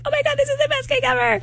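high, gushing fake delight. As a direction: overdo the rapturous praise. Mocking fans praising cake.

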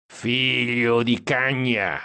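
put-on slow, drawn-out drunken curse from the back seat; mild mock yell, slowed down; drunken shout. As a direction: holler it drunk. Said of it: "urlò Stan dietro".

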